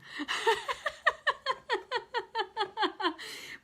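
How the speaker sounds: long, high peal of laughter; amused laughing fit. Real, not acted. Woman amused at the words she chose, long high laugh. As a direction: keep laughing, high and helpless. Laughs at her own word choices.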